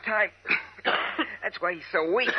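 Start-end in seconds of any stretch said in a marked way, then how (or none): 0.4-1.4 choking cough. Acted. Choking on smoke.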